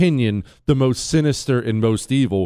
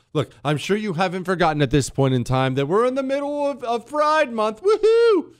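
mock celebration; 8 semitones higher than usual. Host reminding listeners it's Pride Month, mock celebration. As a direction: build into a sarcastic, high-pitched woohoo.

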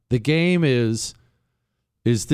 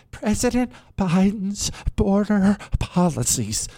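higher, slow, syrupy earnest voice; fake suburban earnestness; 6 semitones higher than usual. Host mocking suburban women's earnest tone, slow and higher. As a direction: overdo the sincere suburban mom. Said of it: "that's the fake suburbia earnestness".

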